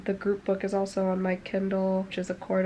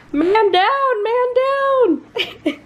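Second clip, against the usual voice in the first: high shouted mock alarm; panicked under fire; 10 dB louder, much slower than usual. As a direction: yell it like a soldier hit. Pickle dropped, mock emergency.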